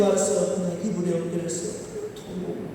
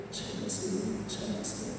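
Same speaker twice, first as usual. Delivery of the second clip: whispered echo; 2 semitones higher, 12 dB quieter than usual. Retold or imitated. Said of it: "계속 통곡으로 우는 거예요".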